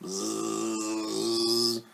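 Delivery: a long steady buzzing bee sound. Acted. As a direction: buzz like a bumblebee landing. Bee lands in story.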